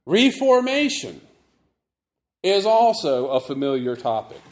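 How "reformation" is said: Reformation with RE stressed, long, high and loud; stress on first syllable. Shown as a demonstration. Preacher announces the sermon topic, stressing RE in Reformation. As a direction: punch the RE in Reformation. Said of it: "notice that I emphasize the first syllable".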